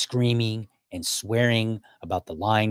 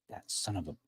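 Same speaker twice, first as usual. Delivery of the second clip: cursing under the breath, much softer; muttered curse; 2 semitones lower, 13 dB quieter than usual. Retold or imitated. Pacing outside the hotel, cursing the boss under his breath, much softer. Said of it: "cussing and carrying on under my breath".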